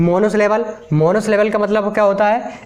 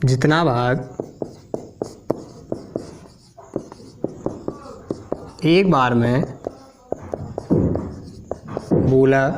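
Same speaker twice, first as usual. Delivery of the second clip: dictation; 7 semitones lower, 7 dB quieter, slower than usual. Shown as a demonstration. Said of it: "थोड़ा लिखते हैं".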